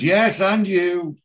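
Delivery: loud, high, drawn-out comeback; mock retort. [name] answering the bloke in the Bentley, mock retort. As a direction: throw the insult back, imitating an indignant driver. Retort to insult from passing car.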